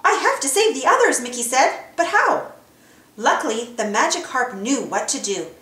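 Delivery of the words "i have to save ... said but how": worried Mickey. A narrator's reading.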